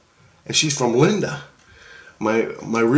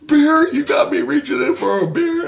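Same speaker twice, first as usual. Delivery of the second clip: incredulous Jesus. Retold or imitated. Jesus mocking the dreamer over a beer, high incredulous squeal. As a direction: squeal it like Jesus can't believe it's beer. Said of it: "and he's like".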